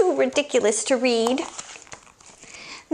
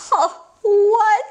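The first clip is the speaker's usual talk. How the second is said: louder, whining outburst; mock dismay; 5 semitones higher, 4 dB louder than usual. Reader shocked by the silly rhyme, mock wail. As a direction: cry out in mock horror.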